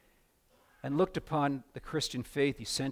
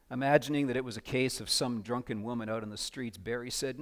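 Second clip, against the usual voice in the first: ordinary talk, nothing marked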